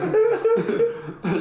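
high squealing laugh; high burst of laughter. Real, not acted. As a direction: crack up mid-story. Laughing while retelling.

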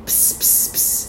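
hissed psst to get attention; stage whisper. Shown as a demonstration. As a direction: quietly hiss psst. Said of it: "psst is more quiet".

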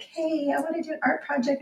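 put-on a high, lightly sketched little girl asking; lightly voiced four-year-old. Mom retelling her four-year-old's morning request, light high child voice. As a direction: play the eager preschooler, only a hint. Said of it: "the little four-year-old was like".

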